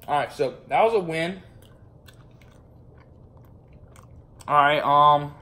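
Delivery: talking while chewing. Real, not acted. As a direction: mouth partly full. Seen 2: Bitten marshmallow held at mouth, more put in mouth at 4.0-4.5.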